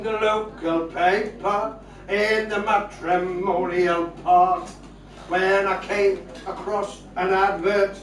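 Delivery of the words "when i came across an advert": ordinary delivery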